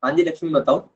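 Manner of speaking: ordinary talk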